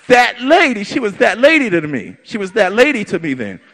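ordinary delivery

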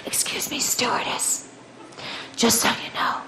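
unvoiced hushed whisper; breathy confidential whisper to a flight attendant. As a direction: lean in and whisper it secretly. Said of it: "she whispers in my ear".

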